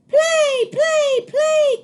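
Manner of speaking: very high, loud chanted demands; eager gosling. A narrator's reading. Baby goose begs Jack to play, very high and loud. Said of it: "cried the baby goose".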